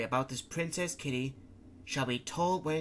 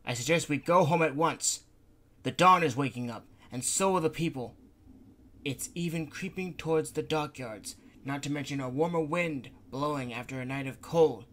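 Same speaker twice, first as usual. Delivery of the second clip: raised, louder, pressing to leave; urgent Gonta; 3 semitones higher, 5 dB louder than usual. Acted. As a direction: push them to go home, voice up. Gonta urges going home.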